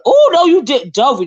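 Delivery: high, loud scandalized outburst; sudden exclamation dropping on the name; mock shock. Real, not acted. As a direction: react as if playfully affronted. Mock shock at a viewer's shade.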